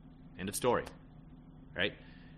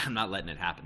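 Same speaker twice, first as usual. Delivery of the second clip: ordinary talk, nothing marked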